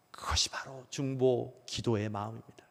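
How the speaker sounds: hushed whispered close. Real, not acted. Preacher whispering the heart of intercession to the church. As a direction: breathe the point out in a whisper.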